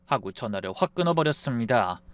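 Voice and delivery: plain reading voice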